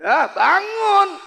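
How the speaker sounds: high, drawn-out yell; rough wake-up call. Acted. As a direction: holler a husband out of bed. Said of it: "sama laki sendiri ngomongnya kasar". (teriakan kasar membangunkan suami)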